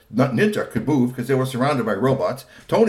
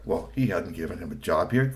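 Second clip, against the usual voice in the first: ordinary delivery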